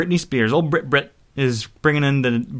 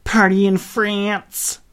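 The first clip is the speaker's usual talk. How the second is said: drawn-out, higher mock line reading; slow, stretched imitation, accent abandoned; given-up accent; 7 semitones higher, much slower than usual. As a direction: imitate a singer dropping the accent, very slowly. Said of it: "not even doing this terrible accent anymore".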